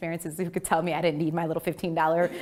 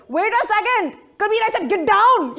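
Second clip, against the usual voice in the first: high, loud mock father voice; dad trying to catch what she said; puzzled dad; 14 semitones higher, 9 dB louder than usual. Father on the phone, hammed-up and puzzled.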